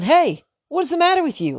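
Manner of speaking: a dad losing patience, voice flying up; exasperated yell. Retold or imitated. Dad yelling at the rough older boys, exasperated and high. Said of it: "And I'm going".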